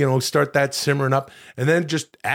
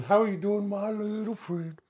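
cooing at pet; 6 semitones higher, 7 dB quieter than usual. Real, not acted. Man coos at his little pet, high baby talk. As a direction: coo softly up high, like to a puppy.

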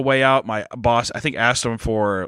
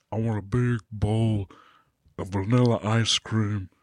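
deep slow Shaq voice; 3 semitones lower, 5 dB quieter than usual. Retold or imitated.

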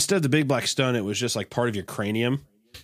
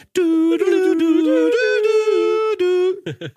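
high tinny compressed doo doo; sung audio imitation; 8 dB louder, much slower than usual. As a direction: hum it like squashed video music. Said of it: "a super compressed, like".

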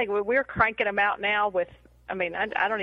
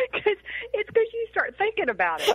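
high-pitched laugh breaking through words; 11 semitones higher than usual. Real, not acted. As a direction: laugh while you talk. Laughing hard at a friend's story.

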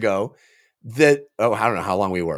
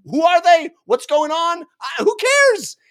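high, loud mock bewilderment; hammed-up confused outburst; mock movie character; 11 semitones higher, 3 dB louder than usual. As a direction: squeak out the baffled lines like a lost extra.

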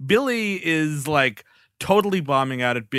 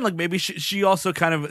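ordinary delivery

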